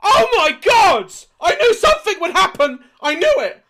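very loud, high shock outburst; startled shouting. Real, not acted. Player reacting to a sudden scare, startled shouting. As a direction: yell as if just jumped out of your skin. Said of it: "that really made me jump".

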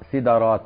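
سدرات as "sidarāt", i then short a; a after d. Shown as a demonstration. Teacher lists valid plurals, سدرات said "sidarāt". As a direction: say سدرات with kasra on s, fatha on d. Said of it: "وفتحها".

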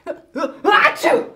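big hammed-up sneeze after a laugh; loud theatrical achoo. Grandma sniffing her flowers, loud fake sneeze.

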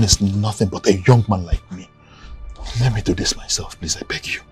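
very soft, sinking to a whisper; hushed pleading. Acted. Son begging his father to step aside, hushed plea. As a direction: beg under your breath.

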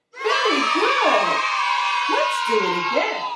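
bright, big teacher praise; cheery praise. Acted. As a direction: praise the class warmly. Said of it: "teacher praising children".

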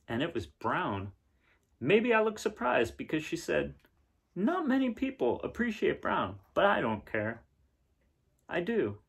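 brighter, livelier read-aloud voice; story narration. A narrator's reading. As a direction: read it to the kids with wonder. Reads picture book aloud.

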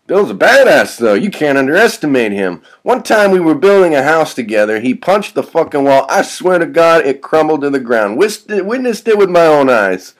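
loud, high, fired-up boasting; animated character voice, climbing at the end; excited braggart vagrant. Acted. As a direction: rave about a friend's feat.

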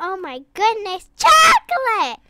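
high, loud surprised cry of Chocolate; startled shout. Real, not acted. Kid startled, shouting to Chocolate. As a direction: call out in shock.

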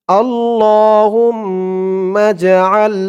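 আল্লাহুম্মা drawn out very slowly with long hold; slow recitation. Shown as a demonstration. Teacher modelling pronunciation and elongation, slow recitation. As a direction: intone আল্লাহুম্মা with a long stretch. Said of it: "আইন দিয়ে লাম ধরবে".

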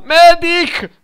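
loud, high call of medic. Real, not acted. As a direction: shout for the healer like in Team Fortress. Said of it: "Come si fa qua a urlare medic?".

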